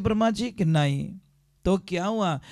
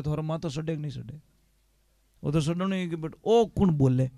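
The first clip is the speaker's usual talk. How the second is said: ordinary talk, nothing marked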